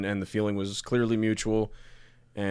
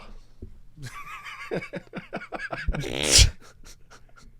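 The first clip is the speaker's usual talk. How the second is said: shrieking laugh. Real, not acted. Big laugh after the joke.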